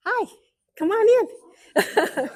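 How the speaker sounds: high, singsong greeting; welcoming call. Real, not acted. Speaker breaks off to welcome newcomers in, high singsong call.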